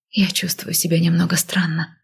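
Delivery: plain reading voice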